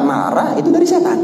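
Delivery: soaring preacher emphasis; high emphatic repeat. Shown as a demonstration. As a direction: pitch jumps way up on Marah itu dari setan.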